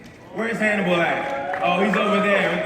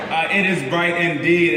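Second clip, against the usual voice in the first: normal talking voice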